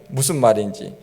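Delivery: ordinary delivery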